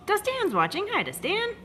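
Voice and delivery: excited greeting. Real, not acted. Greeting a viewer who joined.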